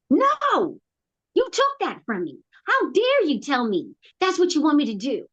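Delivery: high-pitched retold outburst of refusal; acted-out indignant objection; indignant protest. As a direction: push back in protest, pitch jumping up. Acted protest back to God.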